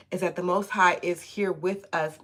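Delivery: ordinary delivery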